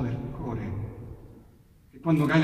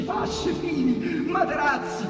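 building excitement; 10 semitones higher than usual. A narrator's reading. Narrator recounts Romans throwing supplies down, excitement building. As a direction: pile it up breathlessly. Goods rain down from windows.

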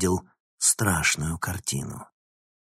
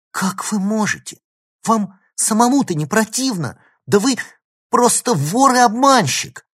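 high-pitched, building to loud denunciation; outraged accusation; 13 semitones higher, 8 dB louder than usual. A narrator's reading. Girl denounces professor as a thief, increasingly outraged. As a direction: start appalled, end shouting the accusation.

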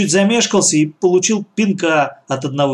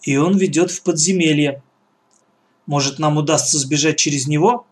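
plain reading voice

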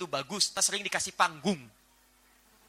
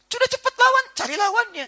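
excited urging; 5 dB louder than usual. Acted. Preacher urging quick remedy.

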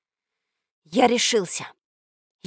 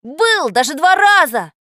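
loud, higher, emphatic protest; indignant insistence; 7 semitones higher, 8 dB louder than usual. A narrator's reading. Boy swears he was in that land, indignant. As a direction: insist hotly, drawing out the end. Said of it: "возмутился я".

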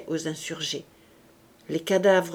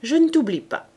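higher, louder, pointed threat to the captive spy; Enjolras to his prisoner; 6 semitones higher, 5 dB louder than usual. A narrator's reading. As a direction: remind the spy he's not forgotten. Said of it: "il se tourna vers Javert et lui dit".

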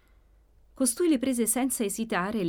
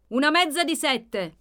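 higher-pitched call to the kitchen; 7 semitones higher, 4 dB louder than usual. A narrator's reading. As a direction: call out an order to someone in the next room. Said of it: "gridò l'oste verso la cucina". (gridato verso la cucina)

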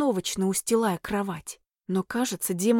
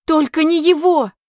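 high, loud desperate outburst; loud cry; 7 semitones higher, 10 dB louder than usual. A narrator's reading. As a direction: cry it out, refusing. Heroine's inner outburst.

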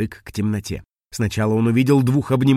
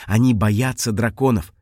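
plain reading voice

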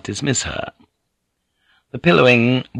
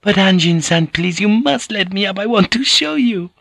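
put-on very high, accented woman's voice; pouting, high-pitched protest; protesting Japanese lady; 10 semitones higher than usual. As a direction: plead with your lover to let you up. Voices Mariko, held down by lover.

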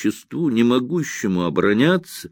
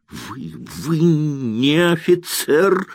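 high, slow, choking with rage; breathless outraged accusation; 8 semitones higher, slower than usual. Acted. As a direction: struggle for breath between the insults. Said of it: "задыхаясь".

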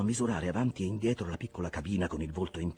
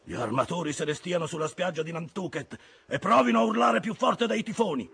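raised, loud and high; scornful outburst; 11 semitones higher, 8 dB louder than usual. A narrator's reading. Ahab scorns the owners on Nantucket beach, angry shouting. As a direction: rant against the owners, building to a yell.

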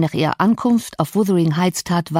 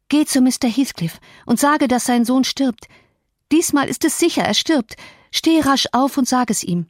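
high, urgent cry for help; urgent calling out; 7 semitones higher than usual. A narrator's reading. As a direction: call out in alarm, high-pitched. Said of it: "indem sie rief".

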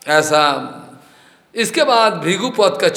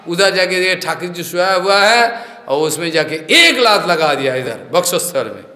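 ordinary talk, nothing marked

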